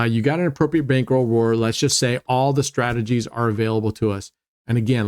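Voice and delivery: ordinary delivery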